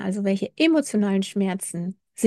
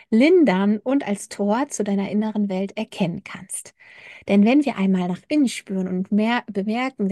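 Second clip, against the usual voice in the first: ordinary talk, nothing marked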